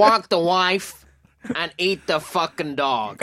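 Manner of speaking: slow, drawn-out mock Long Island accent. Shown as a demonstration. Co-host riffing the sentence in a mock Long Island accent. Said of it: "continues his accent turn".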